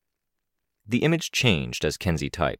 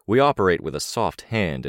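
ordinary delivery, nothing marked

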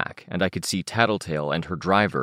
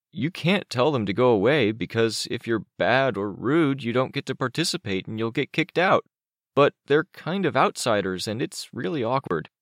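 put-on higher, boyish character voice; Chicken Little explaining; 6 semitones higher than usual. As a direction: explain it like an uneasy kid.

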